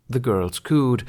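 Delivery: ordinary delivery, nothing marked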